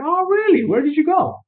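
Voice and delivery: high, exaggerated oh really; mock interest. Caller feigning interest in the receptionist's vacation, high and eager. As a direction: overplay your interest in her trip.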